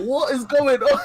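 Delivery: high, loud, laughing disbelief; incredulous cry breaking into laughter; retold bewilderment. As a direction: act out total bafflement, close to cracking up. Retold confusion at hearing the game.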